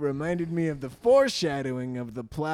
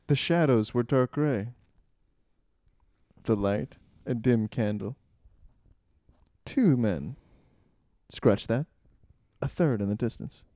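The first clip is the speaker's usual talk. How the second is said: epic narration; 3 semitones higher, 4 dB louder than usual. Acted. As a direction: paint the scene with drama.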